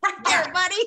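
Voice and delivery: high-pitched heckler's yell; mock fan heckle. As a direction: heckle him like a fan in the crowd. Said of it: "Hey, Cass, right there, buddy".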